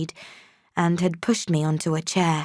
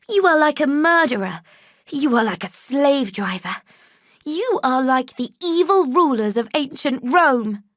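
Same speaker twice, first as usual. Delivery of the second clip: indignant accusation; 6 semitones higher, 3 dB louder than usual. A narrator's reading. Jane denounces John.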